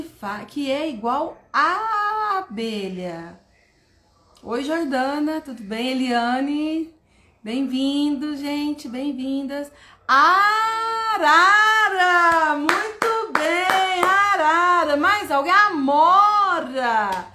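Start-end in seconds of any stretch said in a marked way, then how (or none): 0.9-3.4 "abelha" slowed, first A drawn out; extended vowel. Shown as a demonstration. Teacher asking what starts alike, long A in "abelha". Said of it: "Vogal estendido". 10.1-17.3 loud, higher, drawn-out cheering; excited praise. Shown as a demonstration. Teacher cheering participants' answers, excited and drawn out.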